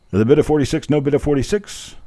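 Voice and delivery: high, loud auctioneer call. Shown as a demonstration. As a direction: bark the bid like an auctioneer. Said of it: "so many ways of wrong".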